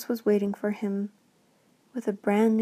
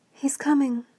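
high, loud excited call; girl spotting her father coming home; 5 semitones higher, 4 dB louder than usual. Acted. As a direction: call it out across the snow. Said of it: "she called".

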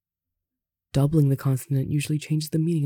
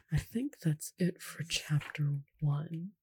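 slow, hushed whisper; whispered aside; 8 dB quieter, slower than usual. Real, not acted. Reader checks where chapter one ends, slow whisper. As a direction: whisper it slowly, almost no voice.

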